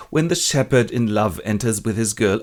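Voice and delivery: ordinary talk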